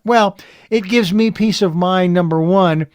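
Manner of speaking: ordinary talk, nothing marked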